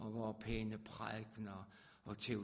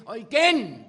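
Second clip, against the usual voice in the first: shouted climax, much higher and louder; full-voiced shout; 11 semitones higher, 24 dB louder than usual. Preacher crowning the refrain, ecstatic shout. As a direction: let the last one explode.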